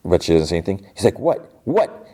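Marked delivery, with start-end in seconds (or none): 1.2-2.1 high, short goading questions; taunting demand. Retold or imitated. Jod to silent Fern, taunting questions. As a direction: imitate someone daring her to speak.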